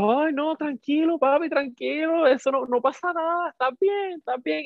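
very high, cooing reassurance; imitated soothing. The couple comforting him as he cries, high imitated soothing. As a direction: comfort someone in a high, put-on voice.

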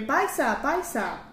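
mimicked local calling to a compatriot; imitated caller. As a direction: call out as if flagging someone down. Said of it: "te decían".

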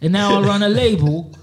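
lightly put-on London street accent; london roadman accent. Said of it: "Me, I'ma use this, what's this guy".

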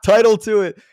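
amused at how hard it is to title this talk, laughing; laughing while talking. Real, not acted. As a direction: laugh your way through the line. Laughs finishing the sentence.

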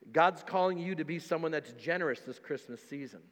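usual speaking voice

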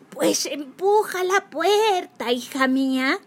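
wolf playing a sweet granny. A narrator's reading. Wolf posing as grandma.

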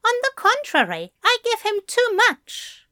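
high-pitched, quick contradiction; Aziraphale countering. Acted. Aziraphale answering back.

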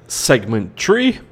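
slow, stretched-out segment three; drawn out. Real, not acted. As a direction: draw out three slowly. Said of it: "Yes, I said three".

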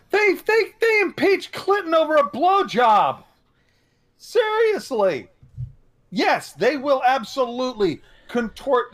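high, loud indignant outburst; outraged shout. Real, not acted. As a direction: shout it in disbelief at the hypocrisy. Outraged about the Clinton impeachment.

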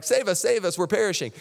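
high, urgent mock panic; panicked plea. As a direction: beg like men in a sinking boat. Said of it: "they're like".

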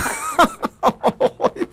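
high nervous chuckle; nervous laugh. Real, not acted. Caller apologizing for a wrong number laughs nervously. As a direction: let a nervous laugh slip out. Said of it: "me río por los nervios que tengo".

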